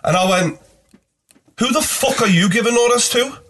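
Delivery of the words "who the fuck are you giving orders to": high-pitched, confrontational challenge; acted angry retort. Passenger challenging the cab driver, acted anger. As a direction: re-enact squaring up to someone.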